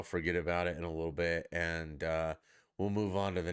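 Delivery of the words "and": ordinary talk, nothing marked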